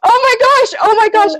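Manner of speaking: can't contain the excitement; still freaking out. Real, not acted. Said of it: "I'm calming down".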